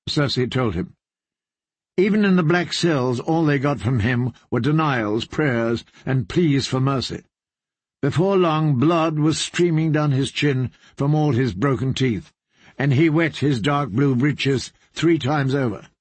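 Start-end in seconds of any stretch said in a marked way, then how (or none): none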